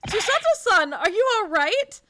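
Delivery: high, loud panicked cries; panicking. Real, not acted. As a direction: scream as the ship hits. Panicking at the crash.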